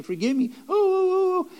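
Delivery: high-pitched imitation of a child wailing; mock crying. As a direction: fake a tearful plea ending in long high "oh"s. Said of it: "they're crying, and they're saying".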